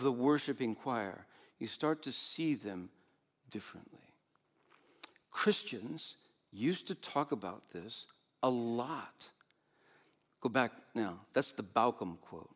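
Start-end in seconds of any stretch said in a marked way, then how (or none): none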